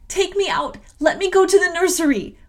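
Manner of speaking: acted frantic outcry; desperate cry. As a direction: cry out to be let out, voice pitched high. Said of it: "was my cry".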